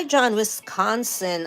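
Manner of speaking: ordinary talk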